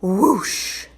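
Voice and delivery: ordinary delivery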